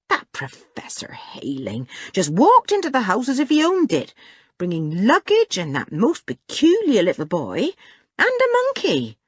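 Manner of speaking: disapproving, rising to a high squawk; indignant grumble. A narrator's reading. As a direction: complain in affronted disbelief. Housekeeper complains about the guests.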